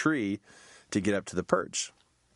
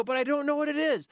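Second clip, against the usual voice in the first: much higher, whiny; high helpless whine; helpless protest. Retold or imitated. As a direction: plead that you don't know. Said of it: "I go".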